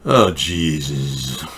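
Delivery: low, slow exasperated groan. Real, not acted. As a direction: sigh it out, exasperated.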